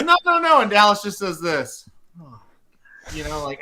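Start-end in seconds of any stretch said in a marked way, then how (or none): 0.0-0.6 high, loud, laughing "no, no, no"; mock woman protesting. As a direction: squeal it like the amused wife. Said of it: "And she goes".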